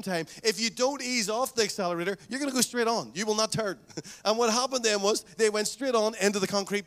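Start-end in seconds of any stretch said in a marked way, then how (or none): none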